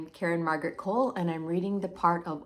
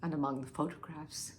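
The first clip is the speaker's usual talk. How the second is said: low, very soft, trailing off; hushed dread; 5 semitones lower, 10 dB quieter than usual. Acted. Wife nears what she found among the photographs, hushed. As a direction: start the disclosure and let it die away.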